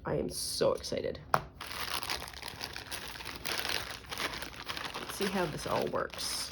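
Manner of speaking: breathy whispered glee; excited whisper. Real, not acted. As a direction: whisper it, thrilled.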